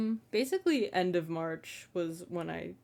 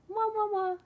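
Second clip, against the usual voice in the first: high-pitched kiss sounds; blowing kisses; 4 dB louder than usual. Real, not acted. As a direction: squeak out kisses goodbye. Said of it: "blows kisses at sign-off".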